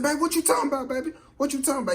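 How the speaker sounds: high-pitched imitation of a confused boyfriend; acted bewildered protest. Confused man protests to girlfriend.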